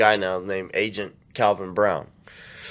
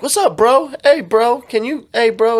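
high-pitched imitation of a fake buddy; high, louder, eager mock hail; mock over-friendly greeting; 11 semitones higher, 5 dB louder than usual. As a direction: imitate a scammer trying too hard with "bro". Scammer faking familiarity with the cousin.

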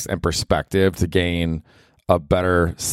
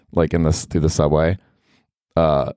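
ordinary delivery

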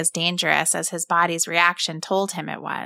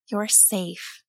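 softer, soothing You're safe; soft reassurance; 7 dB quieter than usual. A narrator's reading. Mother comforts her scared son, soft and soothing. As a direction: hush him gently. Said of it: "she told him".

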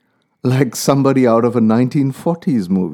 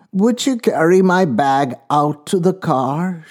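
put-on old woman voice; 5 semitones higher than usual. A narrator's reading. Old woman asks driver.

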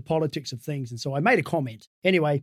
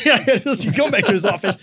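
higher and louder, laughing through it; 8 semitones higher, 8 dB louder than usual. Real, not acted. Laughing as he jokes Crusher's office was a barn. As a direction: let the laugh carry the line up.

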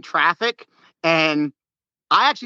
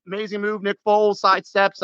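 ordinary delivery

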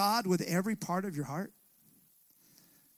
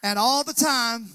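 put-on a Southern old-timer call, raised and louder; lightly voiced Mississippi elder, higher and louder; 3 semitones higher, 4 dB louder than usual. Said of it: "The old timers in Mississippi would say it like this".

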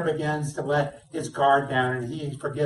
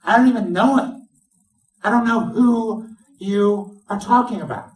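loud, high-pitched flat denial; declarative denial; 7 semitones higher, 7 dB louder than usual. Acted. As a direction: shout him down, swearing you don't know him. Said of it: "he even gets so declarative".

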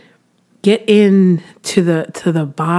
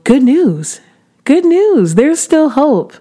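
much higher and louder, ringing good news; joyful proclamation; 9 semitones higher, 5 dB louder, slower than usual. Acted. Preacher announcing good news to listeners, higher and louder. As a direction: shout the good news like a revival preacher, slowing on hope.

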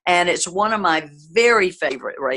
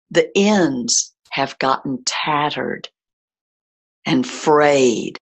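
calm, steady breathwork guidance; guided meditation. Acted. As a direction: lead the listener gently through breathing. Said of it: "leads a breathwork meditation".

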